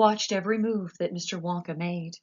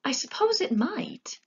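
cheerful; 13 semitones higher than usual. A narrator's reading. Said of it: "cheerful as ever".

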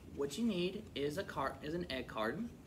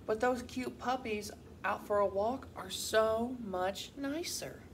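lively, expressive picture-book reading; animated storybook voice; children's story narration; 4 semitones higher than usual. A narrator's reading. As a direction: read to young children with clear, lilting emphasis. Reads a picture book aloud.

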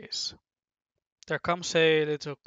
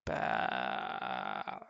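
a much lower, grim mock verdict; ominous verdict; 5 dB quieter than usual. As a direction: drop the voice and pronounce him bad. Narrating the evil shield hero.

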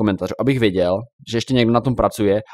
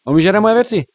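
higher and louder, outraged complaint; indignant outcry; 9 semitones higher, 5 dB louder than usual. Real, not acted. Gamer outraged a mob eats his dropped items, high loud protest. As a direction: protest loudly that he's stealing my stuff.

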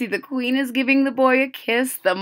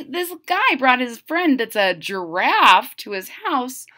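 ordinary delivery

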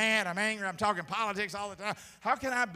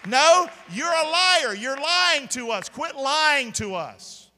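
louder, higher, slow rebuke; angry rebuke; 6 semitones higher, 9 dB louder, slower than usual. Real, not acted. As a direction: call out the liars hard. Preacher denouncing lying officials.

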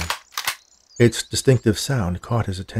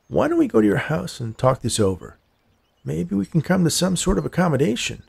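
higher, lilting coaxing voice; affable stranger, conciliatory; 8 semitones higher than usual. Acted. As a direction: coax her gently toward a compromise. Man suggests a compromise.

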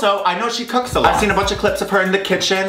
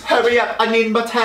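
higher-pitched fake British accent; bad British accent; 5 semitones higher than usual. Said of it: "I cannot do accents well".

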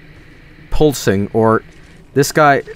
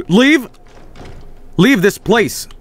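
commanding shout; 8 semitones higher, slower than usual. Pretend. Yelling at the zombie.

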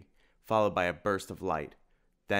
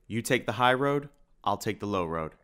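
usual speaking voice

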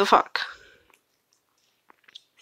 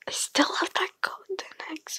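whisper; 7 semitones higher, 10 dB quieter than usual. Acted. Says the line again normally after.

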